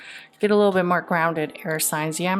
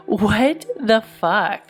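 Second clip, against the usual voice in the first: stunned disbelief; 2 semitones higher, 5 dB louder, much slower than usual. Real, not acted. Reacting to the tarot cards.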